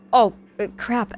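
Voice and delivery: loud, high, sudden oh crap; dismayed realization. Acted. Patient realizing friends missed the party, loud dismay. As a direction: blurt it as the penny drops.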